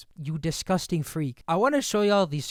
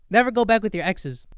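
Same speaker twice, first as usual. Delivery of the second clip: loud warning; 5 semitones higher, 7 dB louder than usual. Real, not acted. Warning viewers about exes.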